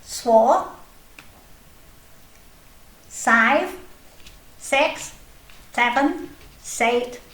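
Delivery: high, cheeky little snail voice counting; Snail puppet voice. Acted. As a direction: play a chirpy snail puppet showing off.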